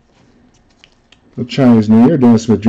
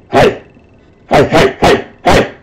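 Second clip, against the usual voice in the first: mock hype shouts, much higher; imitating excited hype; 7 semitones higher, slower than usual. Japanese breaker cheering each big hit, imitated hype shouts. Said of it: "and he would go".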